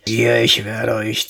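low, menacing policeman's hiss; hissed threat. A narrator's reading. Tall policeman threatens fleeing children, hissing. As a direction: hiss it low through the teeth. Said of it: "zischte ein langer Polizist".

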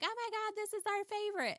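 imitating friends gushing over her scrap quilt. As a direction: squeal like thrilled girlfriends.

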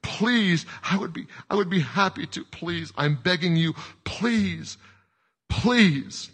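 high-pitched tearful pleading; crying and begging. A narrator's reading. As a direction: beg desperately, repeating yourself. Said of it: "crying and begging now".

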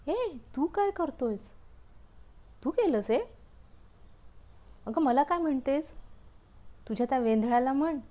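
high, loud accusing retort; indignant quarrel. Acted. Umbrella and cloud trade loud accusations.

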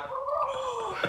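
very high, soft mock gasp; mimicked gasp of delight; imitated starstruck gasp. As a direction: gasp softly like an awed fan, imitated. Retold reaction of Jacko.

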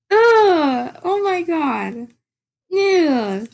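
high, loud crying groan; mock sob. Vlogger imitating her own crying, high mock sob. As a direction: ham up a sob over the sad scene. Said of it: "'cause it was like".

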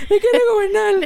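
very high mock refrain, laughing; imitating backup chorus. Podcaster mimicking the band's little chorus, high mock refrain. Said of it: "El corito".

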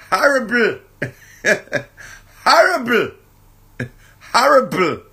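grandma's emphatic Horrible; grandma saying horrible. Retold or imitated. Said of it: "That's what my grandma says".

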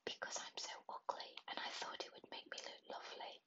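plain reading voice

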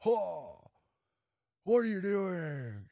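high, slow, unhinged Nicolas Cage; drawn out high and mock-incredulous; Nicolas Cage impression. Host acting Cage's villain to the young guest, high and slow. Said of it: "Nick Cage mode".

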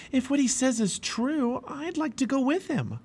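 put-on high-pitched, hopeful boy; pliable boy. A narrator's reading. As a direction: wish aloud in a high, light voice. Pliable wants to join.

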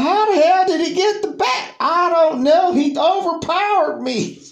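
very high, loud frantic voices; panicked exchange. Retold or imitated. Tim and Alan fleeing Joseph, very high frantic shouts. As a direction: blurt it out in panic.

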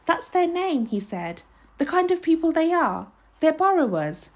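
put-on high-pitched boyish insistence; high, pleading earnestness; earnest explaining. A narrator's reading. Tearful boy explains to his accuser.

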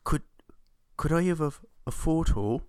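usual speaking voice

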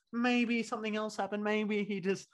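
lightly voiced patronising sister; patronising excuse. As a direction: talk down to him as if excusing a child. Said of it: "this isn't a talking down".

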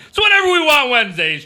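high loud show announcer; hype announcer. Acted. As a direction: sell the catchphrase big. Catchphrase plug to viewers.

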